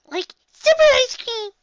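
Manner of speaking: high, loud, drawn-out squeal; giggly excitement. A narrator's reading. Pony insisting snow is ice cream, giddy excitement. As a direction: gush with childish glee. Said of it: "I giggled, nodding".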